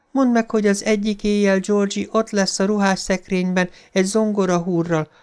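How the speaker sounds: high, eerie voice rising to a push; muffled corrupt voice. A narrator's reading. Voice in the drain taunts Beverly, high and menacing. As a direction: ghost voice savoring the threat. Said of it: "A hang most folytott volt".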